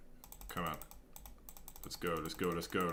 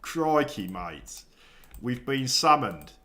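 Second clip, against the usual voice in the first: loud, hammed-up Australian accent drifting cockney; slow, louder mock-Aussie announcement with a cockney tinge; 6 semitones higher, 11 dB louder, much slower than usual. Streamer playing summoned Australian viewers, mock Australian accent. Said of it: "I'm a bit cockney for an Australian".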